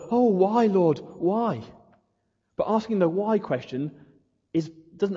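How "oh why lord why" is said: mock self-pitying wail, pitched up; wallowing why. Preacher mocking believers who wallow, self-pitying. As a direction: whine it out, wallowing. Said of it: "wallowing in the".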